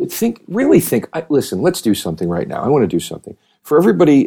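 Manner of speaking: usual speaking voice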